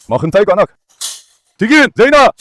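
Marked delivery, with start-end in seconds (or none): 1.5-2.3 high, loud urging; excited call. Real, not acted. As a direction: cheer the dog on. Urging the dog on. (masiglang sigaw)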